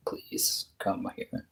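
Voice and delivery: hushed pleading whisper. Retold or imitated. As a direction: whisper it, begging. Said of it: "It's like".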